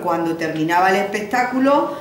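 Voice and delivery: usual speaking voice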